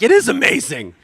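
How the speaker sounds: high, loud, tearful voice breaking on "It is amazing"; imitating someone overcome with tears; welling up mid-sentence; choked-up crying. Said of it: "you're crying".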